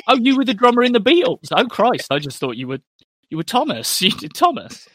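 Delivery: high, loud shocked realisation; a hammed-up, laughing "oh, you were the drummer"; mock surprise. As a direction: gasp at the discovery, voice jumping up. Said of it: "did I discover".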